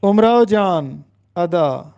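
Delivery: امراؤ said as "umrao", u first; امراؤ جان ادا as "umrao jaan ada"; teacher's model of امراؤ; correct pronunciation. Shown as a demonstration. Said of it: "اکثر لوگ اس کو ... پڑھتے ہیں".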